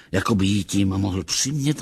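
usual speaking voice

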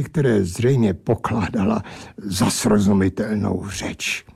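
usual speaking voice

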